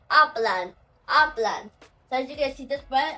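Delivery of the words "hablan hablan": "hablan" with the final n clearly voiced; "hablan" said firmly with a clear closing n; final n sounded. Shown as a demonstration. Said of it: "including the last one, the N".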